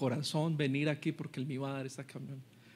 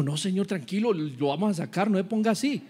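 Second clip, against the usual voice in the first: asesor tranquilizando; 5 semitones higher than usual. Acted. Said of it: "el asesor le dijo".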